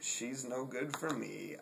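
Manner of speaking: normal talking voice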